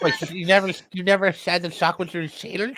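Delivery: high, creepy lisping voice; lightly voiced creepy whisper-talk. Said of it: "That's creepy as fuck".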